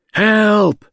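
high, loud cry of help from a distance; a drawn-out scream for help, not too loud. A narrator's reading. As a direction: call out for help in fear. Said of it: "a long, thin-drawn cry".

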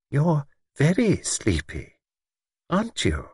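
slow, knowing, pointed question; meaningful tone. A narrator's reading. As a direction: let the hidden meaning show. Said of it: "no mistaking the definite significance of the tone".

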